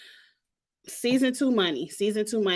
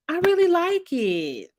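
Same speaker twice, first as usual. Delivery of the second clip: higher, sing-song fake compliment; mock praise; 8 semitones higher than usual. Reviewer faking approval of a boring dress. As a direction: pretend to love it, tongue in cheek.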